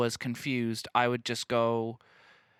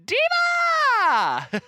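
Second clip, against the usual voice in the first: high, drawn-out screamed DIVA; piercing, full-volume mock shriek; drag queen scream; 9 dB louder, much slower than usual. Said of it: "I think she just screams".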